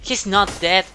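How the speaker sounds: loud, exasperated protest; real frustrated outburst. Real, not acted. Player sees the enemy is not dead, loudly exasperated. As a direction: protest loudly in the heat of a fight.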